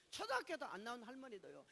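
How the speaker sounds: ordinary delivery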